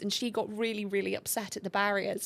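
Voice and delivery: ordinary talk, nothing marked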